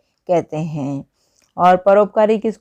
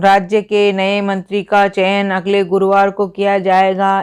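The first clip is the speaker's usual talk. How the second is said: normal talking voice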